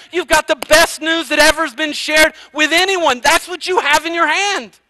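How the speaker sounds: loud, high, fervent exhortation; preaching at full force to a congregation; impassioned preaching. Real, not acted. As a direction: urge the congregation with rising fire.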